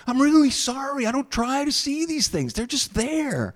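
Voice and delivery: high-pitched, flustered apology; acted anguished self-defence; distressed apology. Seer apologizes for his embarrassing visions.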